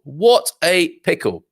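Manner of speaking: a slow, hammed-up sigh of dismay; drawn-out mock dismay. Drawn-out repeat of the exclamation.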